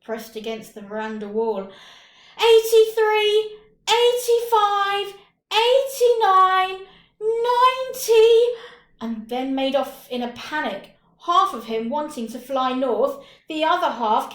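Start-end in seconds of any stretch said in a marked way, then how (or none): 2.4-8.5 loud, high, slow sing-song count; slow, loud, high sung-out counting; shouting the count. Acted. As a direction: chant the numbers out for the hiders to hear. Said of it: "Raghu shouting".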